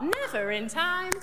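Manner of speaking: ordinary talk, nothing marked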